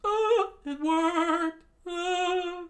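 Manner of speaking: very high, slow falsetto; squeaky singsong relief. Acted. Sanji pleased before the snow buries him, in a high singsong. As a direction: sing it out proudly in a tiny voice.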